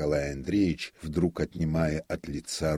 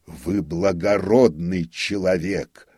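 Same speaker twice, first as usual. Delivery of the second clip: hoarse; 3 semitones higher, 8 dB louder, slower than usual. A narrator's reading. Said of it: "прохрипел нотариус".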